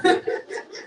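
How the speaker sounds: short airy laugh. Real, not acted. Teacher laughing at a student's fake sick call, short airy laugh. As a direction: laugh at the lame excuse.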